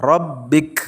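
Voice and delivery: Robbik, short vowel, hard stop on k. Shown as a demonstration. Teacher modelling the short Robbik at a pause, clearly. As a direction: clip Robbik short, no lengthening. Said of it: "Tidak panjang, tapi pendek".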